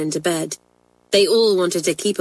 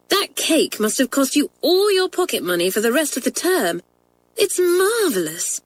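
higher, admiring schoolgirl voice; delighted girl; 8 semitones higher than usual. A narrator's reading. Janet admiring Kathleen's cake, higher and delighted. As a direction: marvel at the cake.

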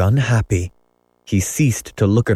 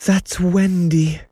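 dreamy mother; 10 semitones higher, slower than usual. A narrator's reading.

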